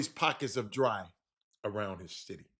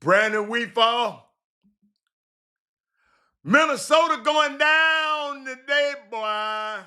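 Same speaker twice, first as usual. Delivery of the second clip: high, loud, slow hype call; hype shout; 7 semitones higher, 4 dB louder, slower than usual. Real, not acted. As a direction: drawl it out like a victory chant. Hyping up a prediction.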